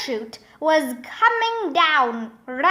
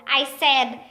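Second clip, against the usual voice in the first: ordinary delivery, nothing marked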